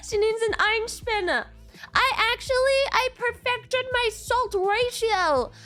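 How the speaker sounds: ordinary talk, nothing marked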